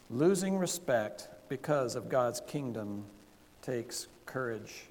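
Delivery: slow, spaced out for note-takers; slow dictation. Shown as a demonstration. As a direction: dictate the point word by word. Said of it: "here's the first point".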